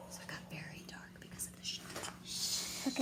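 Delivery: whispered aside; spooky whisper. A narrator's reading. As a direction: hush it like a spooky secret. Spooky aside about darkness.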